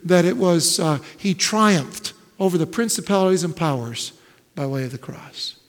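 loud, ringing sermon build; preaching. Acted. Preacher to his congregation, loud full-force preaching.

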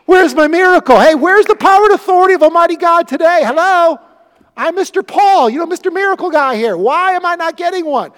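high, loud, whining mock Paul; mock whiny complainer. Preacher mocking a whining Paul, loud and high. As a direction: lampoon a spoiled complainer demanding a miracle.

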